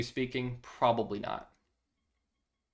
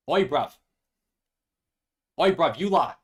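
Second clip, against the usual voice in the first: put-on British accent; 5 dB louder than usual. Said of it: "try a British accent".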